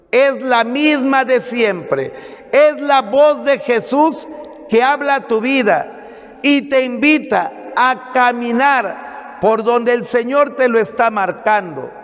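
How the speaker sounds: emphatic sermon in long, insistent phrases; preaching. Acted. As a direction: drive it home to the congregation. Preaches to a congregation.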